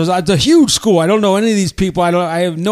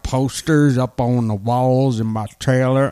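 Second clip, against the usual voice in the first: Sling Blade voice; 3 semitones lower than usual. Acted. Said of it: "kind of a character like this, uh-huh, kinda like a Sling Blade motivated character".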